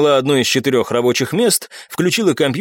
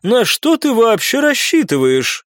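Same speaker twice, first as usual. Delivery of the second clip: woman clerk voice; 9 semitones higher, slower than usual. Acted.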